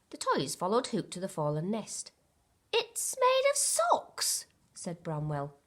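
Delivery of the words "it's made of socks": much higher, slow character voice; lightly voiced toy. A narrator's reading. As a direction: marvel at it slowly. Bramwell character voice.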